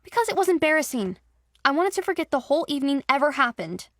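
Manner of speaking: a touch of upset protest; flustered. Acted. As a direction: defend herself, flustered. Embarrassed defensive reply.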